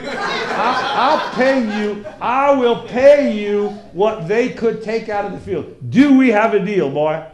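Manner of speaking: loud, emphatic Texan deal-maker; Texan farmer accent. Acted. Farmer closing his deal with student, loud Texan accent. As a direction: press the deal loudly, Texan-style. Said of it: "if you're gonna talk with that accent".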